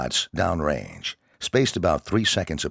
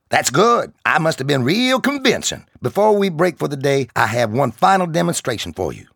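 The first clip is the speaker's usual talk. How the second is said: higher, louder barking sergeant voice; drill sergeant; 10 semitones higher, 7 dB louder than usual. Acted. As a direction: bark it at the recruits like a drill instructor. Sergeant addresses recruits.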